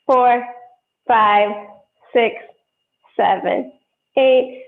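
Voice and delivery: loud, sharp counting to the beat; stern rhythmic counting. Acted. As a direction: call the counts like a dance teacher. Teacher counting ballet class.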